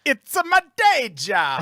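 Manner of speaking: put-on deadpan in a Russian accent. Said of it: "That's the best Russian accent you've done all day".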